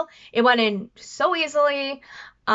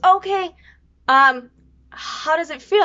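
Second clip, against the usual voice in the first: higher, louder mom voice; imitating mom; 5 semitones higher, 6 dB louder, slower than usual. Mom asking daughter how it feels, imitated. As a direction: act out mom probing for details. Voicing mom's question.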